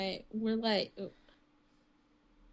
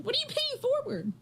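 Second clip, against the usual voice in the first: much higher incredulous question; baffled question; 14 semitones higher than usual. Retold or imitated. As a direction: ask in disbelief. Confused coworkers asking.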